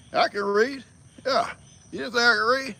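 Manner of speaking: high, loud put-on indignation; mock offended. Joking to viewers that he really can read, mock offended. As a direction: play at being insulted.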